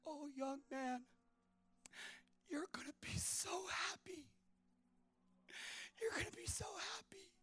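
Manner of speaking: put-on whispered old woman blessing the young man. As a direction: breathe it gently like a very old lady. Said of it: "She goes".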